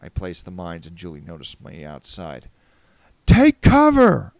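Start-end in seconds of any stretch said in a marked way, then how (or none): 3.3-4.3 full-force high shout, drawn out; yelled warning. Acted. Warning Julie before the mines blow, yelling. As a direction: yell a warning through a wall. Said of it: "I yelled".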